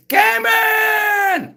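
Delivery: long, high drawn-out shout of a name; excited shout. Real, not acted. Streamer greeting a chatter by name, excited drawn-out shout. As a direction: call out across the room in delight. Streamer calls out to chat member.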